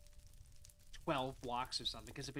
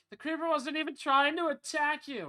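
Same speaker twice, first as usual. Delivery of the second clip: mocking commenter; 12 semitones higher, 10 dB louder than usual. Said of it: "someone was like".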